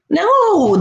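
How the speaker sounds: normal talking voice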